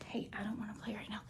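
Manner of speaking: fast hushed aside to the pet; quick whisper aside. Real, not acted. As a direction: brush the cat off in a rushed whisper. Aside to the cat mid-review.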